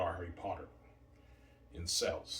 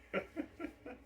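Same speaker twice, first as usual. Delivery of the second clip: short high laugh; 4 dB quieter than usual. Real, not acted. Laughs after punchline.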